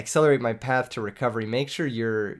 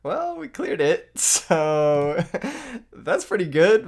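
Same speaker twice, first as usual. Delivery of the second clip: much higher, breaking into laughter; laughing relief; 6 semitones higher, 4 dB louder, slower than usual. Real, not acted. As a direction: laugh through the words in disbelief. Laughing after clearing the board.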